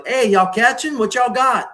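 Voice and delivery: high, loud shout across the water; calling out. Retold or imitated. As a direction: holler at a passing boat. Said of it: "when we pass them by".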